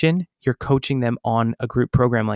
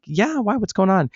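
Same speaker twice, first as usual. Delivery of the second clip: high, alarmed retold question; imitating his own startled reply, pitched up; quick worried yeah, why; surprised; 8 semitones higher than usual. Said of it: "And I'm like".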